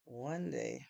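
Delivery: ordinary talk, nothing marked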